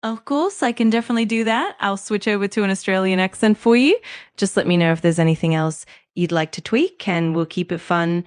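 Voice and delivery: put-on easygoing Australian accent, lifted at the start. Assistant switching accents on request, Australian accent. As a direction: speak English with an Australian accent. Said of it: "Can you speak with an Australian accent?".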